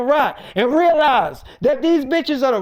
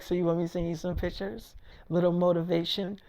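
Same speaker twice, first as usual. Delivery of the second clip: much softer, low flirty murmur; 7 semitones lower, 12 dB quieter than usual. Retold or imitated. Erica to David on the phone, soft flirty murmur. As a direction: coo it down the phone.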